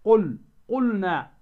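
slow, loud "قل، قلنا" with the l held before n; drawn-out model "قل، قلنا", l never merged; clear lam. Shown as a demonstration. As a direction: keep the lam distinct in "قلنا". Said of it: "أظهرت اللام".

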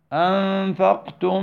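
أنفقتم من with meem merged and held nasal, slow; idgham demo. Shown as a demonstration. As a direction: stretch ما أنفقتم into من. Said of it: "انظر". (إدغام شفوي بغنة)

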